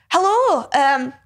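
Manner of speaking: a hint of a Scottish lady asking politely; Scottish woman. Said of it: "a Scottish woman ... she goes".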